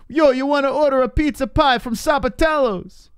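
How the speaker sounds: loud, very high cartoon Italian-American voice; shrieky Italian-American parody; mock Italian-American pizza pitch. Pizza pitch riffing on Italian name.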